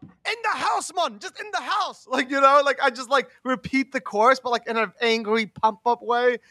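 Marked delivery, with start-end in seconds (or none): 0.0-2.1 much higher reggae hype man chant; mock reggae hype man calling out. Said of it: "come in as the hype man".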